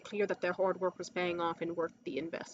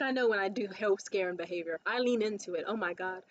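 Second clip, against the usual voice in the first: ordinary talk, nothing marked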